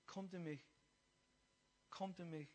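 soft, low, unhurried invitation; gentle calling. Retold or imitated. Jesus inviting the blind man to him, soft calling. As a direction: call him over tenderly, very softly.

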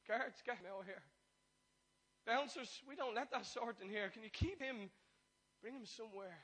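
hammed-up snooty shout; bossy doorman. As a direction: order the bouncers to throw him out. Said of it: "Not like".

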